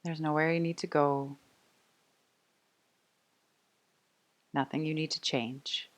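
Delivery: soft, unhurried meditation voice with long pauses; guided meditation. Real, not acted. Yoga teacher inviting the class to rest, calm guided meditation. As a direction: settle them gently into noticing the breath.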